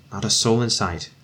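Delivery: ordinary delivery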